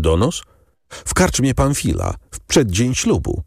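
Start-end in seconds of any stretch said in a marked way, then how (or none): none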